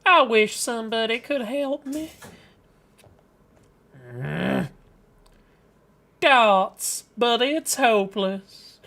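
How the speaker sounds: Southern Psyche. Acted. Psyche wishing somebody could help her, mournful Southern accent. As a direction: lament hopelessly with a Southern twang. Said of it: "Psyche is Southern now".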